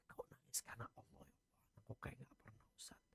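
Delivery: hushed aside, breath only; whisper. Acted. Preacher privately rebukes the weeping officer, whispered.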